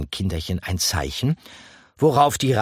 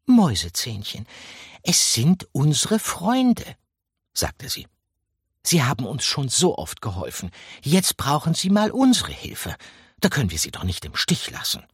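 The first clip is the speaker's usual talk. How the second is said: higher, coaxing mouse wife voice; gentle mouse wife; 7 semitones higher than usual. A narrator's reading. Wife coaxes her husband mouse to help friends. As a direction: talk him round sweetly.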